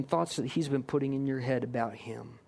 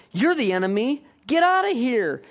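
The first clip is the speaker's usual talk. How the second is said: rising defiant shout; 7 semitones higher, 6 dB louder than usual. Retold or imitated. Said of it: "you'll look at him and go".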